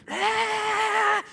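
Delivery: short high yelp mid-ride; a startled high shriek of a cry; ah shrieked as the line drops; scream. Retold or imitated. Scream crossing zipline.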